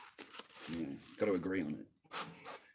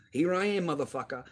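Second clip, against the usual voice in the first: raised, louder mock challenge, pitch jumping up; angry retold taunt; 7 semitones higher, 4 dB louder than usual. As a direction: throw the challenge back hard, like squaring up. Retold taunt in chat room.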